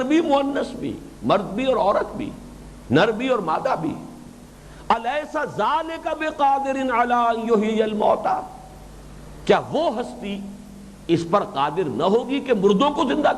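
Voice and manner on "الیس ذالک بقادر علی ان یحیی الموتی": slow, high, sustained Arabic recitation; measured scriptural chant; Quran recitation. Real, not acted. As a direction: recite the verse with drawn-out vowels.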